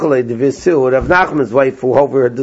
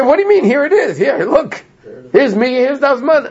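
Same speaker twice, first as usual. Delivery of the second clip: much higher, insistent showing-off; indignant retort; 9 semitones higher than usual. Retold or imitated. Rav Yehuda to Rav Nachman, presenting the summons, high and incredulous. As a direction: wave the proof in his face, incredulous.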